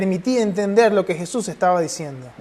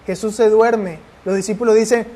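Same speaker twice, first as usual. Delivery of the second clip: usual speaking voice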